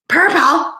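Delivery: high, loud surprised question; startled, pitched well up; surprised echo. Real, not acted. Reacting to a viewer's suggestion.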